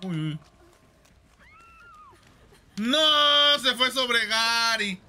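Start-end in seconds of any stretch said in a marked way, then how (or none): 2.8-5.0 alarmed shout. Real, not acted. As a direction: yell it in shock. Killer goes for Gary.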